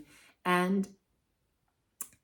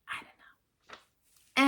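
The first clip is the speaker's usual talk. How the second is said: whispered aside; 7 semitones higher, much slower than usual. Real, not acted. Musing to herself about the woman, whispered. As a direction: breathe it out as a private shrug.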